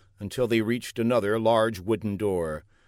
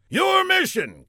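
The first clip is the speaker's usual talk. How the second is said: very high general announcing. Acted. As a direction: announce the mission grandly. General gives mission.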